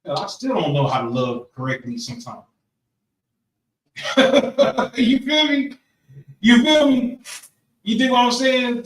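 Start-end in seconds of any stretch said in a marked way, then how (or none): 4.0-4.9 loud, high burst of laughter; hearty laugh. Real, not acted. Speaker laughs about learning to love. 5.0-8.8 higher and louder, still laughing; laughing check-in. Real, not acted. Speaker to the group, laughing.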